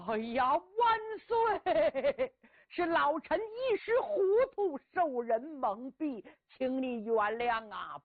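slow, tearful begging for pardon; weeping plea. Acted. Old uncle begs the emperor for mercy, slow tearful wail.

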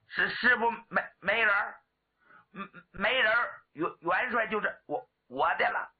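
halting, stuttering challenge; stammer. Acted. Stuttering warrior warns rivals off the contest, stammering. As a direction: repeat and catch on word beginnings. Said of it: "人送外号小磕".

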